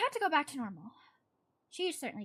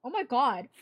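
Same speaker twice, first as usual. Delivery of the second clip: exasperated exclaim; 2 semitones higher, 12 dB louder than usual. Real, not acted. Reader flubs a line.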